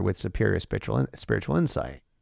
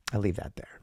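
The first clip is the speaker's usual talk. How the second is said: whisper; 8 dB quieter than usual. Real, not acted. Aside after karma warning.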